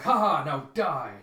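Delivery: loud mock villain laugh and threat; gloating villain. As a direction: crow in triumph over your foe. Voices Zex gloating in the fight.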